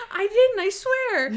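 high, loud mock denial; squeaky-high imitation of a child swearing innocence; protesting child. Retold child denying a murder accusation.

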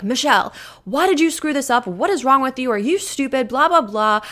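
loud, high scolding boss; harsh boss. Retold or imitated. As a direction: berate her like an angry boss. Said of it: "my boss comes up to me and is like".

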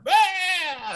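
very high drawn-out monster cry; imitated monster roar. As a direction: mimic the drooling monster's screech. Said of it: "he's just, like, going".

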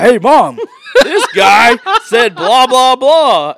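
high, loud mock tattletale, laughing through it; tattling to mom. Friend phoning his mom on air to tell on him, mock tattling.

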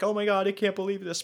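high-pitched imitation of a panicked caller; mock panic. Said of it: "the panicked".

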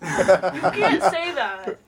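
slow, laughing protest. Real, not acted. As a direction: laugh it out in disbelief. Reacts laughing to joke.

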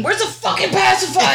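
high, loud exasperated yell; frantic searching. Retold or imitated. Parent hunting a lost pacifier at night, frantic. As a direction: imitate a harried parent yelling. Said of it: "running around the room going".

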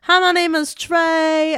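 high, loud, drawn-out greeting; sing-song, stretched mock greeting; imitated student quoting. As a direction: call it out like a kid across a hallway. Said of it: "this, like, one kid".

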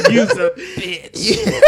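high, laughing slow insult; breaking into laughter. Real, not acted.

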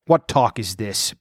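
ordinary delivery, nothing marked

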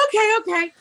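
high, hurried okays; flustered agreement. Retold or imitated. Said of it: "I'm like".

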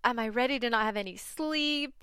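high, anxious run of worried questions; panicking. Retold or imitated. Doubting herself about adding another pet, in high anxious panic. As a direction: fret out loud, voice pitched high. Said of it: "I'm just starting to panic".

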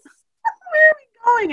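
put-on high, slow pleading kid voice; whiny kids. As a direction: stretch it out like an anxious child. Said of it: "they were like".

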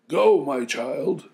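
put-on high, loud, slow old man. Grandfather sending granddaughter off, high and slow. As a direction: bless her slowly as an old grandfather. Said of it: "the old man said".

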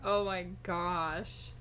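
put-on slow drawn-out valley girl voice. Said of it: "That's what you sound like".

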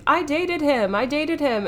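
put-on high, eager old lady boast; gushing about an old flame; excited older woman. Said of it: "this older woman is like".

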